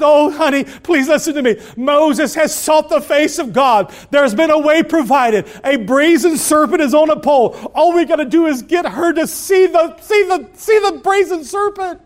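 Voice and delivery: high, urgent coaxing of her husband; excited mother. Acted. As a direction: hurry him toward hope. Mother pleads with father.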